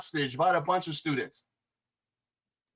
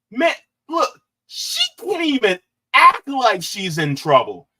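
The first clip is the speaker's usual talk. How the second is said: ordinary delivery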